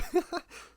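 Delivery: short, high burst of laughter; brief real laugh, pitched high. Real, not acted. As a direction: let out a quick high laugh. Laughing at friend's game joke.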